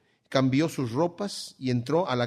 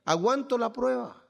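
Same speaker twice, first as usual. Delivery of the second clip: pleading Job; 8 semitones higher than usual. Retold or imitated. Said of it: "el mismo Job dice".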